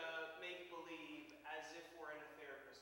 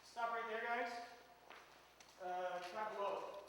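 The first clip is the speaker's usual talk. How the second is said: loud call to halt the group; firm loud stop order; 7 semitones higher, 8 dB louder than usual. Real, not acted. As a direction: stop them all mid-scene. Director halting the actors.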